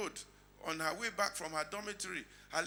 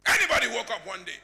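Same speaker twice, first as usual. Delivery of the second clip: raised, forceful rhetorical question; loud preaching challenge; 4 semitones higher, 13 dB louder than usual. Real, not acted. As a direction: call it out hard to the whole church.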